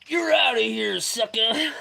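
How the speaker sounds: high, loud mocking send-off; gleeful taunt. Real, not acted. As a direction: crow it just before bursting into laughter. Gleefully booting a viewer, laughing.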